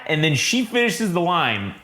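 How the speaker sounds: usual speaking voice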